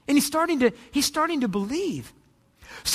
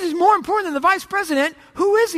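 high, loud, astonished question; incredulous captain; 6 semitones higher, 4 dB louder, faster than usual. Acted. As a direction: demand an answer in acted disbelief. Captain acted, baffled by the answer.

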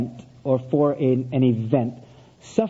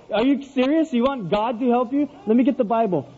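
higher-pitched disbelieving father; incredulous; 6 semitones higher than usual. Retold or imitated. Father hears son call on God, incredulous. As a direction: react with exasperated disbelief.

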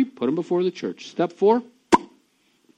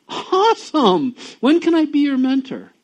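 overjoyed; 11 semitones higher, 8 dB louder than usual. Retold or imitated. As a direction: greet the good news with delight. Imagined happy reunion with friend.

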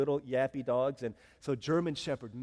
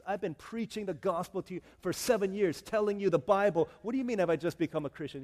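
loud, higher acted indignation; indignant protest; 5 semitones higher, 7 dB louder than usual. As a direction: build up in offended disbelief, acting it. Missionary indignant at villagers' question.

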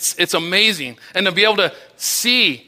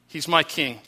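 softer echo; 4 semitones lower, 6 dB quieter than usual. Pretend. Congregation repeating their devotion, softly. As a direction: drop it softer, an intimate echo. Worshippers declare Christ their king.

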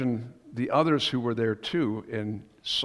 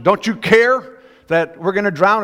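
high, loud frantic demand; the disciples yell at the sleeping teacher; 6 semitones higher, 9 dB louder than usual. Retold or imitated. Disciples woken in the storm cry out, high and loud. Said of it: "The first one is".

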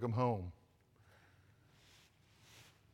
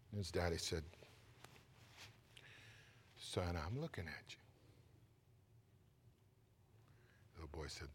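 tender hushed storytelling; 6 dB quieter than usual. Acted. Preacher telling the grieving boy's story, soft and tender. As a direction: draw them in gently.

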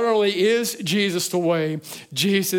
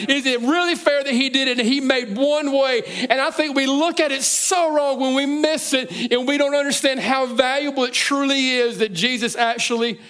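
higher, louder preacher's push; fervent preaching; 7 semitones higher, 5 dB louder than usual. Acted. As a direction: lift and press the rhetorical questions. Preaching climax again.

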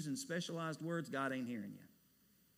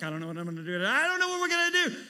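wife's worry, rising high and loud, fast; a hint of his wife freaking out, climbing to a high cry; imitating panicked wife; 8 semitones higher, 10 dB louder, faster than usual. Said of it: "She's like".

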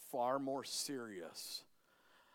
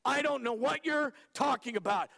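loud denial; 10 semitones higher, 6 dB louder than usual. Acted. Peter's denial.

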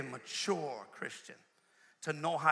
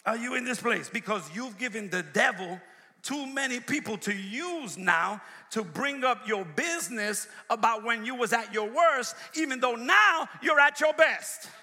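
building preaching; 4 semitones higher, 5 dB louder than usual. Real, not acted. Preacher warning the congregation about oversharing, building preaching. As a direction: preach it, rising to a loud, high climax.

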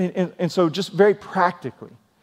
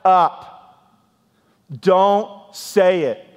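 slow, firm, louder commands; stern Holy Spirit; 3 dB louder, much slower than usual. Retold or imitated. The Spirit scolding a hard-headed believer, stern and slow. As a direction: lay down the law word by word. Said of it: "If you're a little more hard-headed".

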